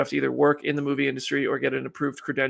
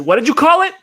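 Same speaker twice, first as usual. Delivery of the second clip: high, shouted mock London outrage; loud, high London heckle, voice climbing at the end; mock London accent; 13 semitones higher, 13 dB louder than usual. Imagined Londoner confronting the streamer, mock outraged London shout. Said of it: "Next time I go to London".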